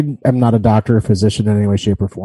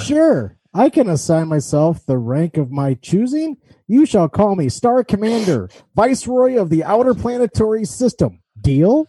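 much higher, pompous mock-regal read; grandiose reply read aloud; 7 semitones higher than usual. Reading Brian's joking text reply to the Army recruiter, pompous. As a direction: crown yourself Star Commander with mock grandeur. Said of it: "So now I'm Brian responding".

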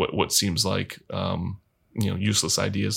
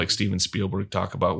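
ordinary talk

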